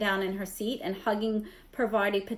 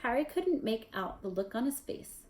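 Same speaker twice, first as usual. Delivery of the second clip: plain reading voice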